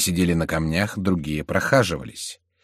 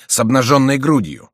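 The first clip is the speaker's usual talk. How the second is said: loud, raised declaration; bold exclamation; 7 semitones higher, 7 dB louder than usual. A narrator's reading. Антраге answers how they will fight, bold exclamation. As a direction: call it out defiantly. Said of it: "воскликнул Антраге".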